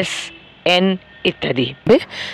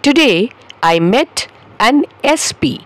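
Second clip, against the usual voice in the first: Today I met an S P, slow and clear; slow model sentence; 6 semitones higher, much slower than usual. Shown as a demonstration. Teacher modelling the short form slowly. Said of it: "ঠিক এইভাবেই".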